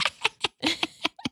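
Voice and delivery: high cackling witch laugh. Acted. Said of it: "Is that your witch laugh?".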